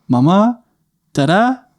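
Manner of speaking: put-on high little-boy voice, rising questioningly; Adam. Little boy calling for his parents from downstairs, high questioning voice. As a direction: call out like a small child unsure who's there. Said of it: "our little guy, Adam, go".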